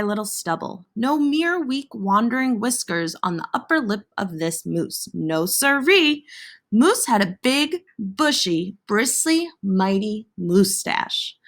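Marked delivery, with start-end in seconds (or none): none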